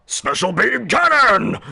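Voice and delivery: slow, high, drawn-out battle shout; stretched warrior yell; anime attack shout. Acted. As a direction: call out your special attack. Piccolo calls out attack move.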